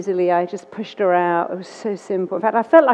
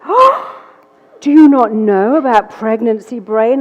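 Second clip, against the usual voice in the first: condescending midwife; 2 semitones higher, 6 dB louder than usual. Retold or imitated. Midwife lecturing the new mother, patronising and loud. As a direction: talk down like a know-it-all midwife.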